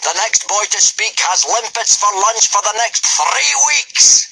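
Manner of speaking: high, loud roar of a Viking giant. Acted. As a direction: roar for silence. Gobber threatening.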